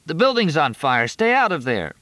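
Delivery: high urgent shout; yelling. A narrator's reading. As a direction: warn them off loudly. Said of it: "the attendant yelled".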